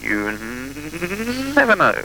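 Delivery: squeaky high mock warning; high ominous sing-song. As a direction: raise it high and spooky. Teasing ominous echo.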